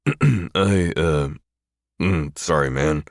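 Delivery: grunt. Acted. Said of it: "he grunts".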